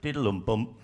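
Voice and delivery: "Diddle-um-bump" low and falling; "Diddle-um-bump" as a cynical, sinking cadence; downbeat rhythm. Shown as a demonstration. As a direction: land "Diddle-um-bump" with a downward thud. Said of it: "much more cynical and downbeat".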